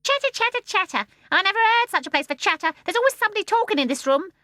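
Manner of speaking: very high, loud, scolding bird; shrill voice. A narrator's reading. The starling scolds from the window in a shrill voice. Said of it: "said a shrill voice".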